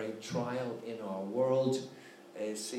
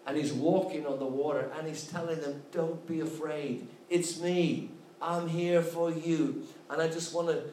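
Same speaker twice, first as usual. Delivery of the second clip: preaching build; 3 semitones higher than usual. Acted. Preacher assuring the congregation God is with them, rising fervour. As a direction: lift the sermon up to its peak.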